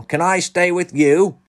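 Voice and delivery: high, loud, stretched-out plea; shouting. A narrator's reading. Wendell begging to join them, shouting. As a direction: shout it eagerly and slowly. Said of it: "shouted Wendell".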